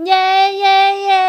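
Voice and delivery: put-on loud, high-pitched little-girl hooray; child's squealing cheer. A narrator's reading. Girl thrilled dad is coming along, squealing cheer. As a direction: cheer like a delighted small child. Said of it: "คนหนึ่งกรีดกราด".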